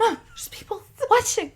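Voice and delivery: high squeal through laughter; real helpless giggly plea; laughing protest. Real, not acted. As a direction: beg mom to behave while cracking up. Scolding mom while both laugh.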